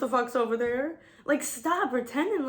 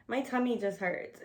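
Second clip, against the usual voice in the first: ordinary delivery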